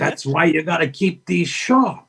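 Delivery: slow, deliberate Pacino drawing out atrophy; Al Pacino impression. Pacino explaining atrophy to her, slow emphatic imitation. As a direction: hammer the lesson home like an intense mentor. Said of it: "your imitation is genius".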